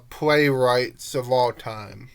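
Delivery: ordinary talk, nothing marked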